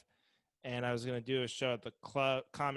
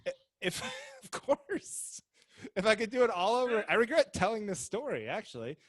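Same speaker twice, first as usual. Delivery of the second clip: laughing; 6 semitones higher, 7 dB louder than usual. Real, not acted. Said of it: "aren't you glad".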